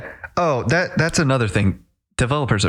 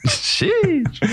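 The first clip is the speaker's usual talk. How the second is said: laughing. Real, not acted. Reacts to "artist's best work.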